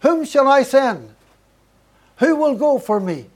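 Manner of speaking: high, loud calling voice of God; a big loud calling out; crying out. Retold or imitated. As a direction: call it out loud across the crowd. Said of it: "crying out".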